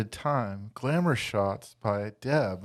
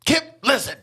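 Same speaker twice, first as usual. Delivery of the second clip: higher, louder call to get his attention; calling sharply; 12 semitones higher, 5 dB louder than usual. Acted. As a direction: snap his name to make him turn around. Grandma calls Kip from the computer.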